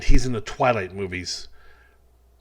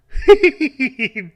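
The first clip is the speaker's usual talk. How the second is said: high loud cartoon chuckle; Homer Simpson laugh; 14 semitones higher, 8 dB louder than usual. Comedian as Homer Simpson laughs, high and loud. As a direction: giggle like Homer. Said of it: "Homer Simpson".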